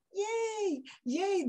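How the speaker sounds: put-on high, soft baby cheer; little toddler voice. Grandmother mimics the toddler's tiny voice, high and soft. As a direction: squeak it like a one-year-old. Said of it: "that little voice".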